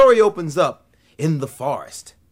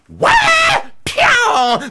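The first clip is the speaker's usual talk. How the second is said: high yelp and whoosh; rabbit's yelp; 9 dB louder than usual. Acted. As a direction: shriek high as the rabbit bolts. Rabbit flees screaming.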